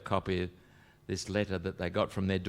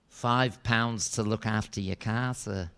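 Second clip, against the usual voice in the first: put-on slow menacing little kid, lowered volume; tough kid; slower than usual. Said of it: "tough as you like".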